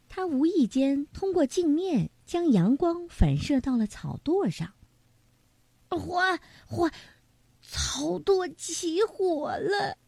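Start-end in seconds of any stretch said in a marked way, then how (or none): 5.9-10.0 panicked cry. A narrator's reading. Fire breaks out, panic.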